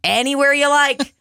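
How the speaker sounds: perky hostess repeating herself; cheery server voice. Retold or imitated. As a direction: say "Anywhere you like" as an upbeat welcome. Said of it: "I go".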